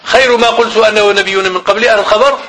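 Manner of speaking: ordinary talk, nothing marked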